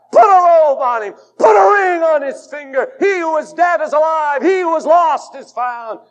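fiery revival preacher; preaching at full force. Acted. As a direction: proclaim the father's joy.

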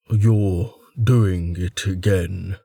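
low, slow companion voice; Shet voice. A narrator's reading. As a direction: drawl it low and unhurried. Shet speaks behind Tristan.

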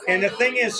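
ordinary delivery

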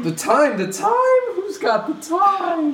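shrill disbelieving squeal; high indignant squeal. Acted. Hatter shrieks at the word time.

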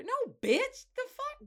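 shrill-high loud sneer; high mocking voice. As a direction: toss it off in a high mock voice.

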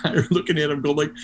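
ordinary talk, nothing marked

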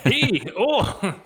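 high, loud mock-Canadian call; Canadian accent. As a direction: exaggerate a cheery Canadian greeting. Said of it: "Is that Canadian?".

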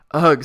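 loud groan of disgust at the food; disgusted ugh. Acted. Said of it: "said the spelling bee, tasting one".

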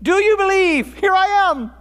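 high, ringing call to a crowd. Retold or imitated. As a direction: proclaim it as a summons to faith. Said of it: "calling the people to faith".

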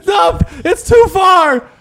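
slow, high, loud protest; high alarmed shout. Pretend. A bystander yells that Sean went too far, high and slow.

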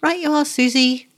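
high-pitched little call back; high, called-out reply. A narrator's reading. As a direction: call it back in a small boy's voice. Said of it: "he called".

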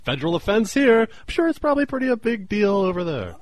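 high, loud laughing accusation; laughing scold. Real, not acted. Friend laughing while accusing him of mail theft.

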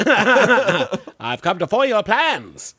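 slow, hammed-up radio hero; drawn-out old-time radio crime-fighter; 1920s radio serial voice. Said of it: "the crazy nineteen twenties radio serial voice".

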